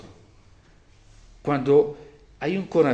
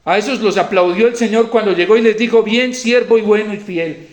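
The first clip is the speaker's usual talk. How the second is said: loud, raised, insistent proclamation; forceful preaching; 8 semitones higher, 10 dB louder than usual. Real, not acted. Preacher honouring priests who died for the sheep, forceful preaching.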